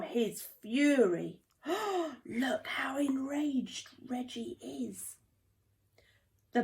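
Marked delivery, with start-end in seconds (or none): 1.7-2.2 frightened gasp. A narrator's reading. Friends gasp at Reggie. 2.3-5.2 slow unvoiced whisper; frightened whisper. A narrator's reading. Friends whispering to each other in fear of Reggie.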